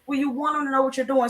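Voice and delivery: usual speaking voice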